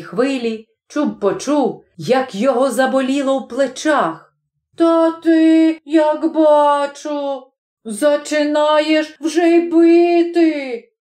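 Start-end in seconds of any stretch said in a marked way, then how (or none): 4.8-10.9 high, drawn-out whine of protest; backing-off protest. A narrator's reading. Chub backing away from the smith, high drawn-out protest. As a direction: protest slowly on a raised pitch. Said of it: "промовив Чуб, відступаючи".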